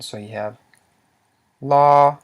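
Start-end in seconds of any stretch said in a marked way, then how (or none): 1.6-2.2 "la" held long on a high, loud level tone. Shown as a demonstration. Teacher models the Lao word for students, high tone on "la". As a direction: say "la" drawn out and high in pitch. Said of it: "pronounced with a high tone".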